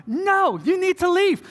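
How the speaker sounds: high-pitched mock call-out; imitated firm order. Stepdad orders ex away.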